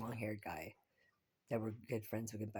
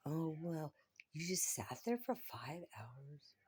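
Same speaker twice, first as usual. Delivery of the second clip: sneering high-pitched mock quote, slowed at the end; 6 semitones higher, slower than usual. Mocking a stranded man who never asked for help. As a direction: scoff at his helplessness in a put-on voice.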